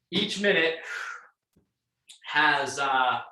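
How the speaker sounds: breathless after a workout; panting between words; out of breath. Real, not acted. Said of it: "catching my breath".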